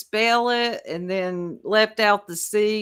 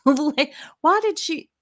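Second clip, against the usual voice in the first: real laugh breaking into high-pitched words; 8 semitones higher than usual. Real, not acted. Host tickled by the eyelashes in a screenshot, laughing. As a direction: crack up mid-sentence, pitch shooting up.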